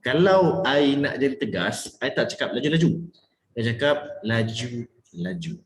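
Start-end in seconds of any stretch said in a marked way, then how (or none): 2.0-3.1 "laju-laju" run together quickly; quick talk. Shown as a demonstration. Coach shows how not to speak, "laju-laju" said quickly. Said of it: "bermain dengan speed cara you bercakap". 3.5-5.5 slow "laju... laju", each word set apart; slowed, distinct words. Shown as a demonstration. Coach shows firm speed, "laju-laju" slow and separated. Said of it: "I perlahankan sedikit supaya dia nampak".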